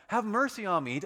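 high, loud plea; calling out. Acted. Blind beggar calls out to Jesus for mercy, loud and high. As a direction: cry it out. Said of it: "he starts calling out".

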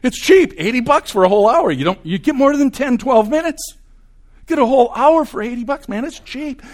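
high, loud bargain excitement; hammed-up sales-pitch eagerness; mock enthusiasm. Patient thrilled by plumber's hourly rate, mock excited.

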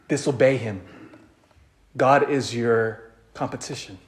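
ordinary talk, nothing marked

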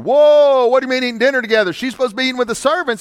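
high, loud, fast incredulous outburst; hammed-up buddies scoffing in disbelief; mock scoffing shout. Good old boys scoffing.

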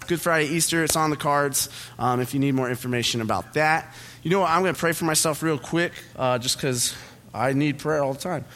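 ordinary delivery

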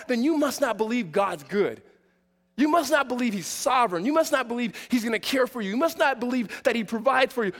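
ordinary talk, nothing marked

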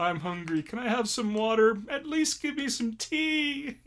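put-on high childish whining; whiny cell. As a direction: beg like a bored child. Voicing stuck blood cells.

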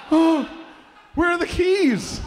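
high, loud weepy imitation; mock crying. Dad believing he got a Porsche, mock tearful cry. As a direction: wail it with hammed-up tears. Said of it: "He started crying immediately".

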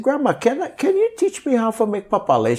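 high, hopeful asking; eager young self. Retold or imitated. Young learner asking grandma to teach weaving: high, hopeful. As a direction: ask grandma sweetly, way up high. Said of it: "So I said".

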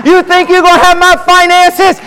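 high-pitched preacher's challenge; defiant taunt. Acted. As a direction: throw it in the devil's face. Taunting the devil while preaching.

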